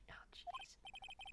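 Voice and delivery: whispering. Acted. Exasperated aside.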